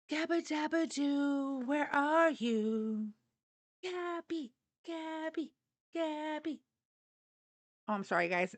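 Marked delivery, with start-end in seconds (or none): 0.1-6.6 gentle high dog-calling voice. Real, not acted. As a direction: coax the puppy out of hiding.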